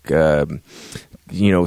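ordinary delivery

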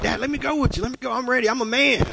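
put-on boy begging dad. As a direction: beg your dad like a nine-year-old who wants to work. Preacher voices his boyhood self begging Dad.

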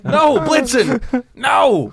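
loud, high mock reprimand; hammed-up outraged "no"; mock scolding. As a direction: scold a naughty reindeer at full voice. Scolding the imagined reindeer.

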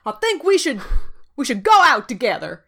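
hammed-up Iowan accent, earnest declaration; mock-earnest Iowan suitor; [name]'s Iowan accent. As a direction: put on a broad Iowan country twang. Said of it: "this accent is really making me write".

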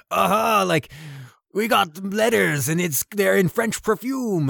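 high-pitched, slow, overdone chatter; over the top. Retold or imitated. Intelligence officer overplays his cover to the German sailors, high-pitched and hammed-up. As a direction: ham it up like a nervous show-off. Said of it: "goes over the top with it".

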